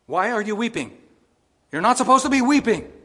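loud, high questioning call; lightly voiced stern Jesus; declaiming Jesus. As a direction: boom the question across the room. Preacher voicing Jesus at tomb.

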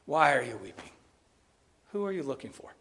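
soft, low, tender questions; hushed tender Jesus; gentle Jesus. Shown as a demonstration. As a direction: ask softly to comfort her. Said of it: "I don't think he had a scowl".

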